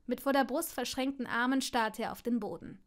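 usual speaking voice

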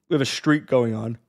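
words pulled out of shape by a yawn. Real, not acted. Coach explaining the gold streak, yawning mid-sentence. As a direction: talk right through a big yawn. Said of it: "Sorry I'm yawning so much".

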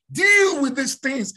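sudden loud, high preacher's command; forceful command. Real, not acted. Preacher ordering the nation, loud and high. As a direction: hammer it home at full force.

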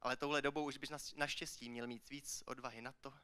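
low, soft spoken-word delivery; hushed poem ending. Acted. Poet ends the poem on a missing punchline, hushed and low. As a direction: drop right down, leaving pauses between the lines.

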